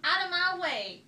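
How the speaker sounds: high loud order; loud bossy goose; bossy shout. A narrator's reading. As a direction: shove them aside loudly. Goose shoves others.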